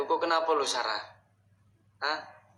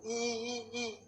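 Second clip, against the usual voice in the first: soft high mock sobs; imitated crying; 9 semitones higher, 8 dB quieter, slower than usual. Man mimicking the ghost crying once more, soft mock sobbing. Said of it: "nangis lagi".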